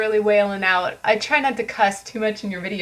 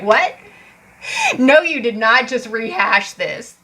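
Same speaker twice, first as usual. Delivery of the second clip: louder, mildly outraged challenge; indignant comeback; 2 semitones higher, 5 dB louder than usual. Acted. Reader acting out a clap back, indignant. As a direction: turn on them in mock disbelief. Acted comeback to a smug opponent.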